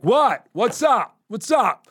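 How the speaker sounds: high, loud, squaring up; tough challenge. Retold or imitated. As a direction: call out a challenge to a stranger. Confronting staring stranger.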